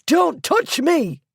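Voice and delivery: high, slow horrified scream. A narrator's reading. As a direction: recoil and scream it. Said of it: "the schoolmistress screamed".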